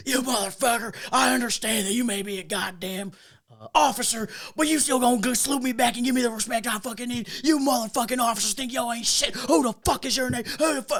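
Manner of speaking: lightly voiced angry sergeant tearing into him; angry old sergeant. Thirty-year enlisted man chewing out a fake officer, angry. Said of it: "this thirty-year-old guy ... is like".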